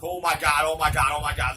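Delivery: loud, rapid-fire panicked repetition; retold panic, easing off in volume; freaking out. Boy trapped by the door, mildly acted panic. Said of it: "I'm freaking out".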